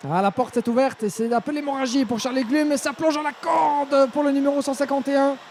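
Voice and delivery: high, rising race call; excited motorsport commentator. Real, not acted. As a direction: call the chaos as it unfolds. Rivals collide, positions lost.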